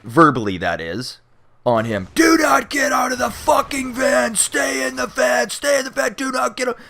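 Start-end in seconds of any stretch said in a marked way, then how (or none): 2.1-6.8 high, forceful shouted commands; police yelling at the friend opening the van door, furious shout; cops shouting. Retold or imitated. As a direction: bellow the orders like riot cops. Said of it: "the fucking cops unload, verbally that is".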